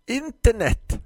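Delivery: put-on high, loud old shop lady spitting the word out; hissing florist. Grumpy florist hisses the word at her customer. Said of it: "fräste Tisten".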